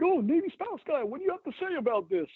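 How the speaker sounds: high, teasing mock voice; mocking commenter. Imitating online commenters taunting the Navy spouse guy, high mock voice. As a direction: sneer it up high like a taunting troll.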